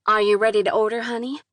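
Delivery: southern drawl. Acted. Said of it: "thick southern drawl".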